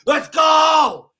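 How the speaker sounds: high, loud yell to the opponent; fired-up shout. Retold or imitated. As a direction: roar him on, pumped and wild. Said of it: "I was like".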